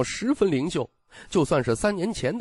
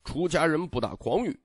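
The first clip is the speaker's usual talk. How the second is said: plain reading voice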